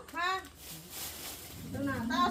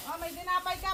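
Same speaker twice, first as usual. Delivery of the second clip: ordinary talk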